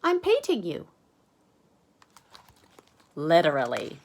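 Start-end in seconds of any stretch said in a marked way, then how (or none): 0.0-0.9 put-on very high cheerful kid voice; Max innocent. Max explains he paints Arthur, very high cheerful voice. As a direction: say it brightly pleased. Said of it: "And Max says".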